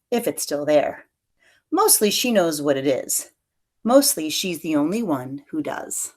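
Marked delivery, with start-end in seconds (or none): none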